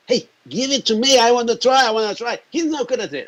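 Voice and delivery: put-on high-pitched, childlike pestering; mock the eager pleading of kids wanting a turn; excited clamouring. Journalists begging to try the wand, excited clamour.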